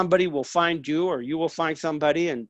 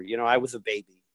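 ordinary talk

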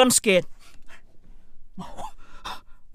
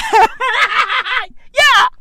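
hammed-up shouted yes; mock shouted yeah; 10 semitones higher than usual. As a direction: bark out the yes like a taunt. Reenacting hearing, mock shout.

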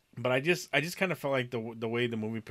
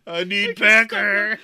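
high, loud, slow drawn-out yell; mock scream; 11 semitones higher, 14 dB louder, much slower than usual. Friend echoing the coworker's scream, slow loud mock yell. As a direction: stretch out a mocking yell. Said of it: "screamed out".

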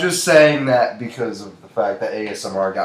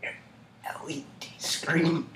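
ASMR whisper; 3 semitones higher, 10 dB quieter than usual. Shown as a demonstration. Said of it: "Even quieter, though".

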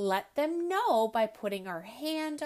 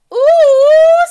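much higher, loud squeal of surprise; surprised ooh; 26 dB louder than usual. Acted. Ellie lifted by the trunk, loud high squeal. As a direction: squeal as you're lifted off the ground.

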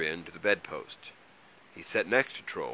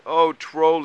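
loud, high pleading apology; apologetic cry; 10 semitones higher, 12 dB louder than usual. A narrator's reading. Mole rushes out to Troll, apologising loudly. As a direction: call out sorry, pitched well up.

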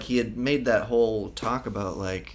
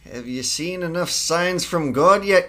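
mock challenge; 9 semitones higher, 11 dB louder than usual. Mate loudly demanding the captain heed the signs. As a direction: hammer the captain with a rhetorical challenge. Quoting the mate's line from film.

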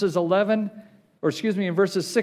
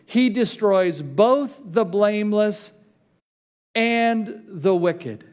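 slow emphatic preaching; slower than usual. Acted. Pastor drives home Job's bitter verdict, slow and weighty. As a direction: land the verdict slowly and heavily. Reads Job aloud.

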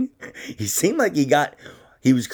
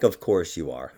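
dry retort; 8 semitones lower, 4 dB quieter than usual. Retold or imitated. Dry retort after her squeal.

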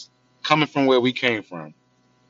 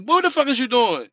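mock scold; 9 semitones higher than usual. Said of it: "go in there like".

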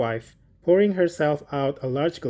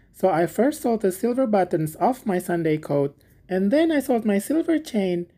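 higher, earnest humble voice; little Hans; 7 semitones higher than usual. Acted. Hans telling the miller why he sold things, high and earnest. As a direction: let Hans plead his hardship simply. Hans tells of his winter.

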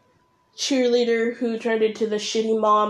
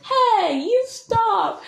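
high, swooping mock yell; inflected argument yell; 11 semitones higher, much slower than usual. Shown as a demonstration. Creator shows how a quarrel really sounds, high inflected yell. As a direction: pitch shooting up as if yelling. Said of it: "you change the infliction".

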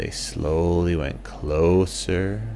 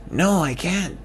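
lightly voiced bat; 12 semitones higher than usual.

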